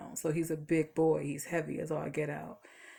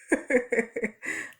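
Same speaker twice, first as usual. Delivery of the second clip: bursts into a higher, louder laugh; real laugh; 5 semitones higher, 5 dB louder than usual. Real, not acted. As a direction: laugh at her own cheating. Laughs about repeating days.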